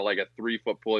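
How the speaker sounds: ordinary talk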